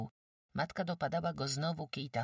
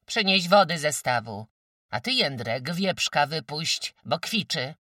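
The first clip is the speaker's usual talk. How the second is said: loud, raised-pitch commands; barked orders; 7 semitones higher, 10 dB louder than usual. A narrator's reading. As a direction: order the sons about loudly. Mother orders her sons about. (głośno, rozkazującym tonem)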